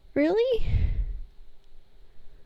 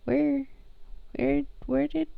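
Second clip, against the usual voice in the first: soft, higher, halting voice; hushed stammering question; 5 semitones higher, 11 dB quieter than usual. Acted. Sammy asks where the tea came from, hushed and halting. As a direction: ask it softly, tripping over the first word.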